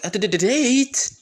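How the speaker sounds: high, loud stammer rising to a squeak; stammer, then burst out the question; stuttering. Acted. Hinata stunned by Naruto's date invitation, flustered stuttering. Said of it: "like, you know, stuttering".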